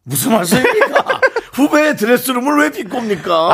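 high, indignant denial breaking into laughter; laughing protest. Real, not acted. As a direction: protest innocence while cracking up. Laughing denial of mocking accusation.

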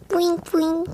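high, soft aegyo coo; breathy cutesy giggly. Pretend. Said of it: "애교 오 종 세트 한번 보여주세요".

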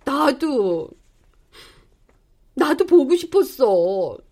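usual speaking voice

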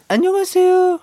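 put-on high, cutesy girl-group greeting; [name] impression. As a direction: squeak out a shy idol hello. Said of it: "아유미 씨 그 성대모사를".

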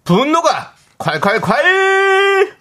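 high, slow and stretched; drawn-out hyped shout. Acted. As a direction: belt it like a jingle. Jingle-like call.

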